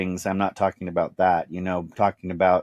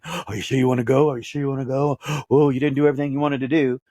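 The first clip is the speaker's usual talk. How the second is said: a touch higher, wheedling doubtful voice of fear with a gasp; voice of his fear; 5 semitones higher, faster than usual. Acted. His fear nagging him at the end of life, lightly voiced and pleading.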